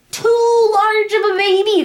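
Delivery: hammed-up doctor's verdict; exaggerated insistence. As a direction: stretch it out in a wail. Mimicking the doctor's alarmed verdict.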